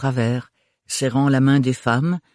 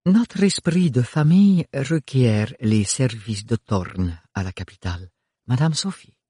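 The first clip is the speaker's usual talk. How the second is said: grand and courteous, rolling the Rs; gracious grande dame. Acted.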